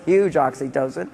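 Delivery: usual speaking voice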